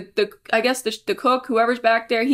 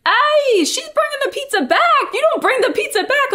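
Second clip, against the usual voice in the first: imitating the shouting drug dealer; 14 semitones higher than usual. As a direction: ham up the pushy dealer yelling across the lot. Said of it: "He's like".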